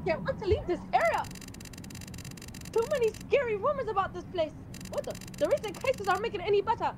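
high, nervous customer. Acted. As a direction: read the lines like a worried shopper. Partner reading customer dialogue.

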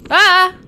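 sudden high loud yelp; shrieking as an enemy lunges; startled scream. Real, not acted. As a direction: jump out of your skin.

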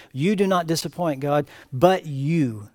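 ordinary talk, nothing marked

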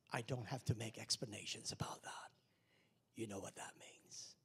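quiet, low whispered voice of God; hushed whisper. Retold or imitated. God speaking to pastor, soft whisper.

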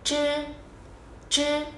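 "chư" tròn miệng, lưỡi cong; "chư" không bật hơi, lưỡi cuộn; tiếng Trung zh. Shown as a demonstration. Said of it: "khẩu hình thì tròn rồi, lưỡi cong nhá". ("chư" cong lưỡi không bật hơi)

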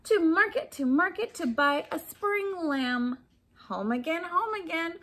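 bouncy rhyme, rising on market; sing-song rhyme reading. A narrator's reading. Reading a rhyme aloud to children, sing-song. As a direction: read the refrain like a nursery rhyme.

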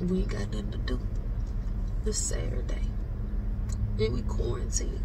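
bored whisper. Real, not acted. Bored quarantine complaint.

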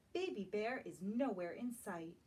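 plain reading voice

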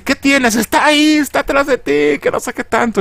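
put-on the girl asking her dealer in a high alarmed voice; high frightened girl voice. Girl asks scared guy, panicking.

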